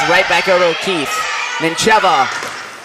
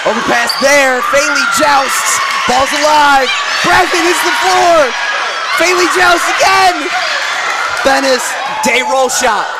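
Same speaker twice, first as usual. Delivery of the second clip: live play-by-play; 7 semitones higher than usual. Acted. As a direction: call the rally as it happens.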